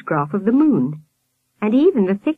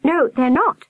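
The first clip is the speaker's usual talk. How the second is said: lightly voiced boy cutting in; interrupting boy; 8 semitones higher, 3 dB louder than usual. A narrator's reading. Albert interrupting the nurse, higher boy voice. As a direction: pitch it up and cut straight in. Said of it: "Albert interrupted".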